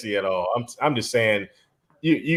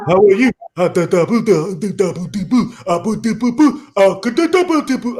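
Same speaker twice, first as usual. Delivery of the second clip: higher, rhythmic gibberish in a put-on African accent; mock African accent; 7 semitones higher, 3 dB louder than usual. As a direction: mimic an African greeting, then babble nonsense syllables. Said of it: "That's exactly how they be sounding".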